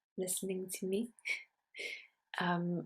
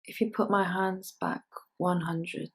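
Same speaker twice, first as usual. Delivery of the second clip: measured poetry recital, firmer than chat; poem reading; 7 dB louder than usual. Acted. Poet reading a pickling poem to an audience, measured recital. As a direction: read it out in deliberate phrases with pauses.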